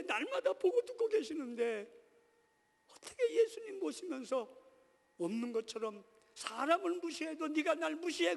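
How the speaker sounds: high-pitched, forceful rebuke from the pulpit; impassioned preaching. Real, not acted. Preacher chides himself for ignoring Jesus' presence, impassioned preaching. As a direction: raise the voice and preach with indignation.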